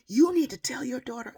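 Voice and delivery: loud, high imitation of her order; mock loud demand. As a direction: bark it at her, mimicking. Woman barking at mother.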